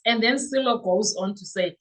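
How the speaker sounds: ordinary talk, nothing marked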